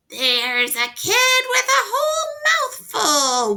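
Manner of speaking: very high, loud cackling screech; screeching helper. A narrator's reading. Helper spotting Norman in bed, very high loud screech. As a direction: screech it with creepy glee. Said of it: "one of them screeched".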